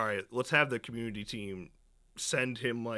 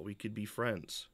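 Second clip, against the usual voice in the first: ordinary talk, nothing marked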